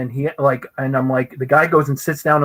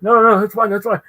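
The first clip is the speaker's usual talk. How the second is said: high, insistent reassurance; starstruck fluster; 7 semitones higher, 5 dB louder, faster than usual. Retold or imitated. Fan to the artist he just scolded, flustered and starstruck. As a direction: trip over yourself insisting it is all right. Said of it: "I'm in shock that it's him".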